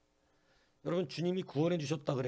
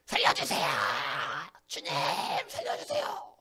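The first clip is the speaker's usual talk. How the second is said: panicked cry for help; 3 dB louder than usual. Acted. Peter sinking in the water cries to the Lord, panicked. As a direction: cry out like a sinking man grabbing for rescue. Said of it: "소리 지릅니다".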